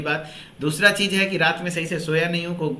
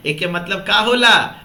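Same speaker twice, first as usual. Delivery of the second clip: mock village question, louder; joking Bhojpuri aside to the students; playful Bhojpuri question; 2 semitones higher, 6 dB louder than usual. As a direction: tease the class in Bhojpuri.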